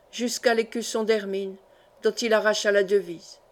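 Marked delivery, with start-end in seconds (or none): none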